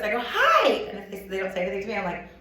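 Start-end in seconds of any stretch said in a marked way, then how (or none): 0.2-0.7 much higher, louder sing-song hi; over-the-top hello when ignored; exaggerated hi. Shown as a demonstration. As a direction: push the greeting way up to get noticed. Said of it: "so I go like this".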